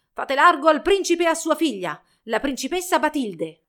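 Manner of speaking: high, loud herald's call; shouted announcement. A narrator's reading. Herald announcing prince and princess, shouting. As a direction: proclaim it down the street. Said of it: "strillò una voce lungo la strada".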